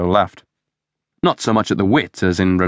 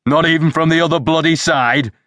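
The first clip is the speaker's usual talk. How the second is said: high-pitched angry bellow; 10 semitones higher than usual. A narrator's reading. Superintendent explodes at his sergeant, bellowing. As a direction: bellow it like an irate boss. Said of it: "bellowed D L".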